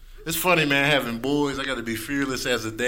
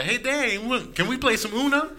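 higher, lightly voiced kid; son's voice; 9 semitones higher than usual. Son asking dad for Uno, lightly higher. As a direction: ask like an eager little boy. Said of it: "my son coming up to me like".

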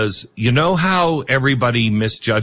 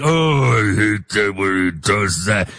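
low, grumbling mock complaint; mock disgust; 6 semitones lower than usual. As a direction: drop low and groan like an annoyed critic. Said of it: "you're like".

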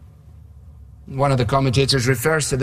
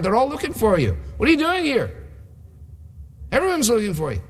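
much higher-pitched, hammed-up reproach; veiled condemnation; 9 semitones higher than usual. Disciples chide Jesus for leaving the crowds, in high-pitched mock condemnation. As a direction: play an exasperated follower, pitch jumping high. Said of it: "also a veiled condemnation".

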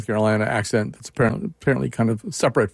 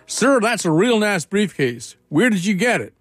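put-on Georgia accent; 7 semitones higher than usual. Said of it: "not do justice to the Georgia accent".

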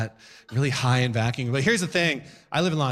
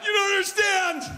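mock insistence. Insisting to crowd.

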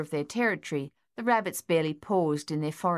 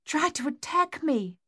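gasped; 10 semitones higher than usual. Acted. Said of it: "their mother gasped".

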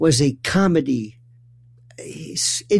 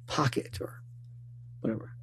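whisper; 16 dB quieter than usual. Real, not acted. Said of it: "conspiratorial aside".

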